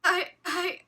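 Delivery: a flustered stutter. Acted. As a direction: stammer out the same word in shock. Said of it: "I stuttered".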